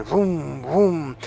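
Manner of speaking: soft mock engine rev; a quick playful vroom vroom; engine revving. Guiding listeners to imagine the car, soft engine noise. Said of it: "Imagine revving the engine".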